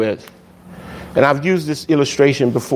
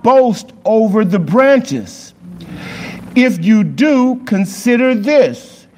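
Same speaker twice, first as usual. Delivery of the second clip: raised, slow pulpit reading; scripture reading; 7 semitones higher, slower than usual. Acted. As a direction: proclaim the verses slowly and high. Reads scripture aloud.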